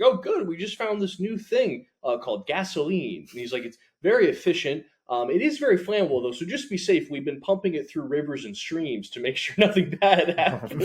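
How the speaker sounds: high, bright mock salesman pitch; hammed-up sunny oilman; cheery salesman. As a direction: sell gasoline like a chipper 1950s salesman. Comedic quote of oil businessman.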